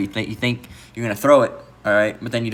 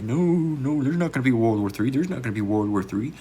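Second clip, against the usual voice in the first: lightly mocking, repetitive denial; mock dismissive denial; 3 dB quieter than usual. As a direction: imitate someone waving off the danger. Mocks people denying a coming war.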